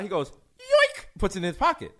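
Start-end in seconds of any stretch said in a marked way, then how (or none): 0.3-1.1 very high cartoon squeak; comic snatch noise. Storyteller imitating the mugger pocketing his phone, comic high squeak.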